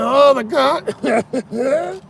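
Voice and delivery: high, drawn-out blurt breaking into laughter; gleeful would-be comeback, laughing; giggly mock exclamation. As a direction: crack up on a stretched, high punchline. Mock reply he almost gave, giggling.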